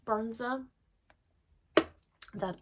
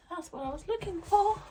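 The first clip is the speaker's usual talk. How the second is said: mock crying relief; 10 semitones higher, 6 dB louder than usual. Finds item, mock crying relief.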